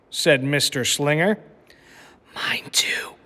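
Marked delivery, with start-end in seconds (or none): 2.3-3.1 breathy hushed whisper. A narrator's reading. Lily whispering to her teacher about the wedding. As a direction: whisper it like a secret. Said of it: "whispered Lily".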